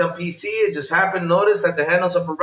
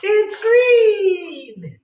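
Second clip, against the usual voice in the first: high, slow cartoon-kid voice, much higher; sing-song kids' show host quizzing the viewer; lightly hammed-up children's TV host; mock Dora; much slower than usual. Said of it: "she's like this".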